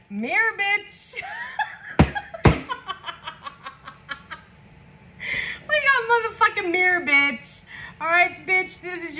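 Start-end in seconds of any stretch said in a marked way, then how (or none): 0.1-1.2 slow, laughing card call. Real, not acted. Reader laughing as the mirror card shows. 1.3-4.3 high cackling laugh. Real, not acted. Reader cracks up at her own reading. 5.7-7.6 high, fast, excited. Real, not acted. Reader hyped as the mirror card appears.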